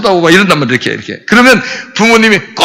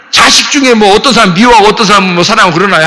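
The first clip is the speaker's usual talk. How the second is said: ordinary delivery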